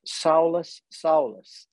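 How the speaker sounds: "Saulus" with a long ow sound and a held final s; calling "Saulus" twice, the second falling; Saulus not Saul. Shown as a demonstration. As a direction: say "Saulus" slowly, stretching the ending. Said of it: "That's what you would have heard. It's not Saul".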